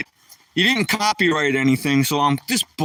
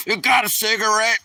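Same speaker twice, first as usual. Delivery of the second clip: lightly voiced smoker; 5 semitones higher than usual. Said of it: "It goes".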